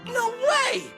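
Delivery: high, disbelieving exclamation; amazed. Acted. Newcomer amazed at the weapons' material, astonished. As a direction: pitch it up in sheer astonishment.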